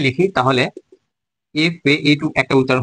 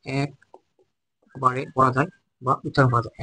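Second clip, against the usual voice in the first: very slow and softer, every word spaced; slow dictation; 7 dB quieter, much slower than usual. Shown as a demonstration. Teacher repeating the definition slowly and softly for students. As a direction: read it out slowly so the class can write it down. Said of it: "slow repeat for students to note".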